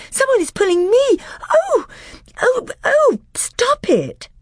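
put-on high, loud frightened girl's cries. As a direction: cry out in terror. Susan dragged by magic.

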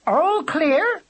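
high, loud call from above. A narrator's reading. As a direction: call down from the sky. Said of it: "she called".

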